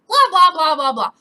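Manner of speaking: loud, high start tumbling down; exasperated mock read-out; mocking blah-blah. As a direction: dismiss the boilerplate loudly. Mocking the email's boilerplate.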